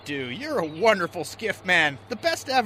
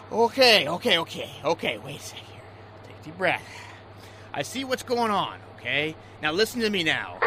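soothing; 5 semitones lower than usual. Acted. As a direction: talk him down gently. Skipper calms crying deckhand.

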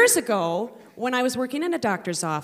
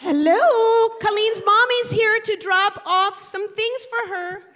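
much higher, louder cooing mom voice; sing-song mommy; 10 semitones higher, 6 dB louder, slower than usual. Retold or imitated. Mom arrives at school to embarrass daughter, high gushing coo. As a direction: announce yourself like a gushing show-off mother.

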